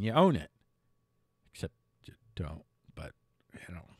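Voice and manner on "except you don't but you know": whispered, fast; whispered aside. Real, not acted. Host whispering a cynical aside about ownership. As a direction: slip it in under your breath. Aside under his breath.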